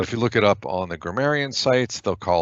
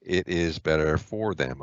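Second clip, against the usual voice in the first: slow, deliberate reading of each verb phrase; teacher picking out the verbs one at a time; slower than usual. Shown as a demonstration. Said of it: "identify verbs first".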